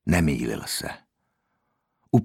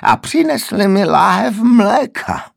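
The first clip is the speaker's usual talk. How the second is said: much higher, louder, slow screech; piercing raised voice; 8 dB louder, slower than usual. A narrator's reading. The old man shrieks indignantly about the bottle of milk. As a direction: let it cut the ears. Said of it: "až to řezalo do uší".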